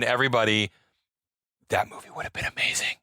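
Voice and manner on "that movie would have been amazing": hushed, breathy whisper. Real, not acted. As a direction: whisper it like a secret. Awed aside about lost movie.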